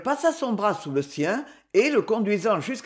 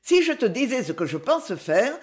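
plain reading voice

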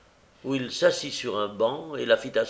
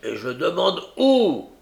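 loud, higher, forceful demand; big gruff voice; 7 semitones higher, 7 dB louder than usual. A narrator's reading. Brigand insists on knowing where Nancy goes, big gruff voice. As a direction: push the question out in a big voice. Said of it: "reprit Sykes avec sa grosse voix".

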